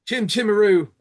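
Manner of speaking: high, loud hammed-up Cockney accent; mock Cockney. Joking about mocking a friend on video, hammed-up Cockney accent.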